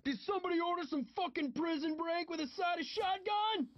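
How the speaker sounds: high-pitched full-force scream. A narrator's reading. John bursts from the crashed Cadillac with a shotgun, screaming. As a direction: scream it out like a wild entrance. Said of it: "He screamed".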